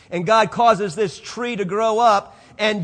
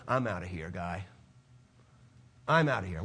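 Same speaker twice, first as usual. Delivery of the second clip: God walking off; 9 dB quieter than usual. Pretend. Voicing God closing the book.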